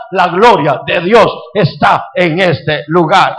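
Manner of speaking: slow, weighty proclamation; solemn preacher's proclamation; solemn declaration. Acted.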